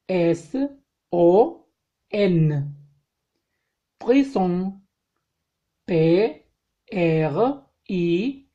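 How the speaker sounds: slow, letter-by-letter French spelling; spelling aloud. Shown as a demonstration. Teacher spelling French words for students, slow and clear. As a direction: spell each word out slowly for the class.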